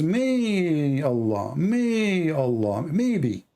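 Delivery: MAY stretched out very long; mock uncertainty. Debater mocks a God who is not even sure, drawn-out doubt. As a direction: draw out "May" as if unsure. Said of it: "He is not even sure".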